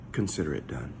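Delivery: low, easy, matter-of-fact reply; casual paraphrase. Shown as a demonstration. Brother Keith's casual version of Jesus, laid back. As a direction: toss it off like a simple favor granted. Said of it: "the Brother Keith version".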